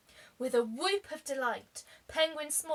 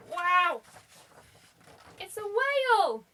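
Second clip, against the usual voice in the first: high, loud cry of amazement; amazed; 7 semitones higher, 6 dB louder than usual. Real, not acted. Reader amazed at the whale picture, high and loud. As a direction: gasp it out in delight.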